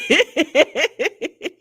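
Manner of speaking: high cackling laugh; real laughing fit, pitched high; helpless laughter. Real, not acted. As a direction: burst out laughing. Cracking up at played clip.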